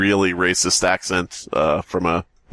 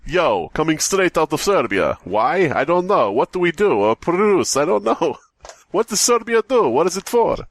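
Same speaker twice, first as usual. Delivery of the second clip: mock rapper persona; 7 semitones higher than usual. Host clowning as rapper Little Serbian, high and hammed-up. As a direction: hype it up like a clueless rapper.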